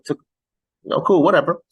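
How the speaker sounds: usual speaking voice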